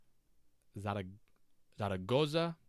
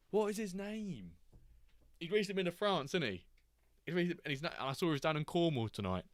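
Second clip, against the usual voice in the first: ordinary delivery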